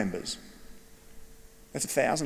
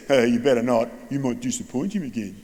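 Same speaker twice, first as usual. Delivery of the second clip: mock warning. Student to swearing classmate, mocking warning. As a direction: tease the mate with a grin. Kid mocks teacher to classmate.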